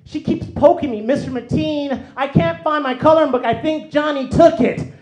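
put-on high, loud, whining child; whiny tattletale kid. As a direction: nag the teacher like a persistent little tattletale.

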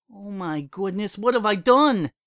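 high, rising to a loud panicked cry; the horror of what she said hits her. A narrator's reading. Mare realizes she called Lyra a liar, panicked. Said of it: "pinpricks of panic".

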